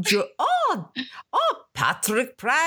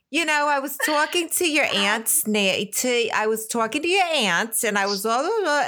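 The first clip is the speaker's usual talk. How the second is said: mock a mother's picked-up New York accent; put-on New York accent; 3 semitones higher than usual. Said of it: "she'd have this accent that she didn't have".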